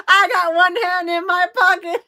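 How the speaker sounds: high, loud joke through laughter; laughing while talking. Real, not acted. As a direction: crack up as you quote it. Jokes with song line, laughing.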